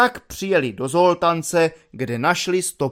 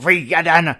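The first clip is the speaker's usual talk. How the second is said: mild roar, clearly louder; 6 dB louder than usual. Acted. The lieutenant roars an insult at the cadet, louder. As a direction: snap it out loud at him. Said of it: "zařval na něho poručík Dub".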